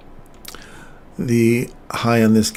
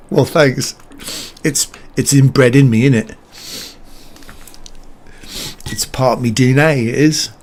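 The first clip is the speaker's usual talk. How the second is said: lightly put-on Portsmouth British accent; a playful British accent, proud of it; 2 semitones higher than usual. Streamer thanks a chat viewer for the accent praise, in a light British accent. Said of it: "You've pulled off a good accent".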